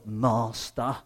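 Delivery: a meek servant's "Master." with a rising finish; submissive servant. Retold or imitated. Imagined Jesus dutifully to his master: soft, meek. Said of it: "It's not almost like, "I'm doing your will".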